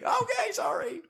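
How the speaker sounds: tiny, high meek apology; cowed kid. Retold or imitated. Kid backing down after dad's shut up, cowed. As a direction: shrink to a small squeaky voice.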